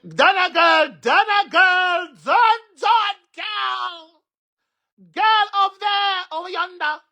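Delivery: shrill drawn-out holler, very high; high, loud, long-drawn yell; high-pitched calling. Acted. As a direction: call out across a distance, stretching the words. Mock calling out to Donna.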